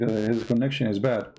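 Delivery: ordinary talk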